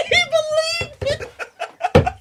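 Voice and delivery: high, slow, laughing so hard it squeaks; high wheezing laugh through the words; howling laughter. Real, not acted. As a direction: crack up helplessly at the prank. Cracking up at his prank.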